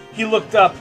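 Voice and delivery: ordinary talk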